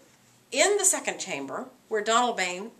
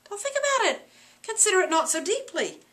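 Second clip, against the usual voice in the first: much higher voice, brushing it off; dismissive Lady Macbeth; 8 semitones higher than usual. Acted. Lady Macbeth brushing off her husband, higher. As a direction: tell him to drop it.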